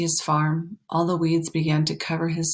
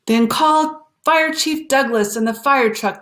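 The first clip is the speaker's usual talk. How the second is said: loud, higher shout for help; farmer shouting; 6 semitones higher, 4 dB louder than usual. A narrator's reading. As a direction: bellow the order across the crowd. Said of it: "the farmer shouted".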